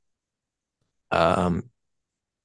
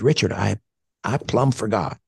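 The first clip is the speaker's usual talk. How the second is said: a friend's Tennessee accent, lightly imitated; Tennessean accent; 4 semitones higher than usual. Retelling his late friend's phone call, a light Tennessean accent. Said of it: "in his wonderful Tennessean accent".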